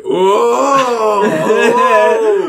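loud, high, long whoas; mock impressed. Stranger reacting to the hoodie price, mock impressed. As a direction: hoot as if hugely impressed.

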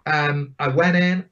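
ordinary delivery

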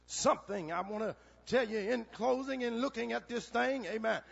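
usual speaking voice

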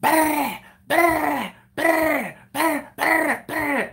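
high, loud rapid brr of tommy guns; imitating tommy guns; mock machine gun. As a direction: rattle it out like gunfire. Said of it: "they're like".

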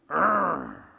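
low, drawn-out triumphant "arr"; satisfied growl. Acted. Preacher savoring fresh smell of cut grass, satisfied growl. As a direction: growl low with pride in the job.

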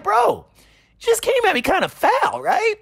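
squeaky mock pleading, offended bro; voicing Referred. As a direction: play the wronged gamer, whiny and high. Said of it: "it's like".

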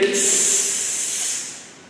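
long, soft hissing sss; snake hiss. Shown as a demonstration. Teacher showing the snake's sound, long hiss. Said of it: "A snake hiss like".

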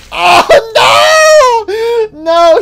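real shout breaking high and loud; anguished cry. Real, not acted. Seeing Boxy Boo land on his butt, a loud anguished cry. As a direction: wail at the crash.

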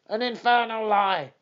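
enraged trainer shouting his denial. Acted. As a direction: hurl the word infernal. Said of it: "he shouted".

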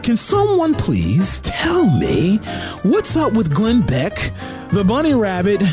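normal talking voice

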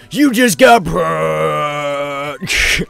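high, slow triumphant yell; gloating shout. Real, not acted. Gamer taunting the punched enemy, slow gloating shout. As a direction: crow over the knocked-down enemy.

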